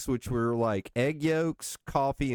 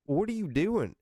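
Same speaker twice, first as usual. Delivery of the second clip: higher, incredulous retold question; amazed buddy; 9 semitones higher than usual. Friend from out of state amazed at ordering, higher incredulous. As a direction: play the friend who can't believe it.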